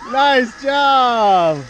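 loud, high excited cheer; excited whoop. Real, not acted. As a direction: yell with thrill.

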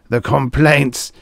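groaning. A narrator's reading. Producer dreads viewers' complaints, groaning. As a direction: wail it, drawn out. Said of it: "the producer groaned".

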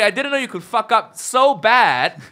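normal talking voice

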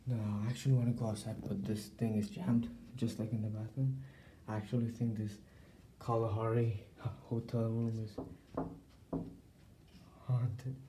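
hushed, nervous talk; nervous night vlog whisper. Acted. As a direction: stay hushed and jumpy.

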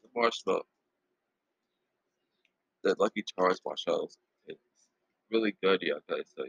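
talking while chewing; savoring a sweet bite; mouth full, chewing. Real, not acted. Seen 0: Puts food in mouth at -5s; savoring, closed-eye chewing face follows.

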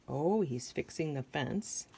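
ordinary delivery

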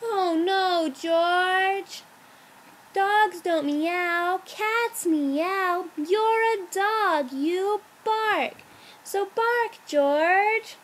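exasperated storybook mom; upset mama dog. A narrator's reading. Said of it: "Mama Dog was really, really upset".

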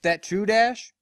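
questioning; lightly voiced farm pony. Applejack questions Dash.

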